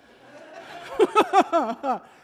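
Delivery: high burst of laughter; loud laugh. Real, not acted. Preacher laughing at Jonah sleeping through the storm, loud laugh. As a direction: crack up fully.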